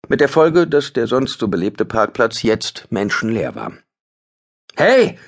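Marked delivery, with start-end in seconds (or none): 4.8-5.2 much higher, loud call of hey; shout. Acted. Ben shouts at the attacker across the street. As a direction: yell to stop the attacker. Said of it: "rief Ben".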